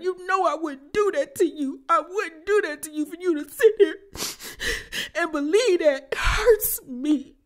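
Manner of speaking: high, wailing mock sob; hammed-up fake crying plea. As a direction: overdo the crocodile tears. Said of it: "And Quad fake crying".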